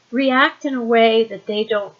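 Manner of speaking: usual speaking voice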